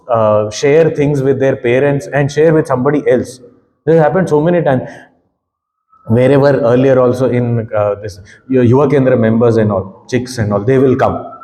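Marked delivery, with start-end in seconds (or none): none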